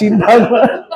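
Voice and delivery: ordinary talk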